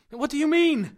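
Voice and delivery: high and loud, yelling over the roar; shouted. Acted. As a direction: call out in alarm, straining to be heard. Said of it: "I shouted".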